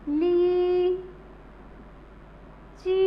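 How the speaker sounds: ली and ची apart, each held long and level; long ee sound. Shown as a demonstration. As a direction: sustain ली, pause, sustain ची. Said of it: "दोनों को लंबा sound लेके बोलना है". (ली और ची अलग-अलग, लंबी खींचकर)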